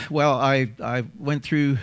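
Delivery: ordinary talk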